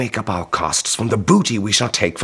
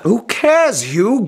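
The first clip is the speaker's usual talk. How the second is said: dismissive outburst; 9 semitones higher, 3 dB louder, slower than usual. Acted. Brother arguing dismissively.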